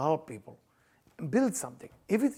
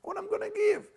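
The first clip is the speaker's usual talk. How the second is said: high, thin mocking voice; high teasing voice; 5 dB quieter than usual. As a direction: toss it off in a high put-on voice. Mock advice voice, high.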